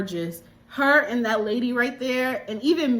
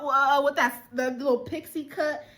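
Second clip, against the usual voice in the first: normal talking voice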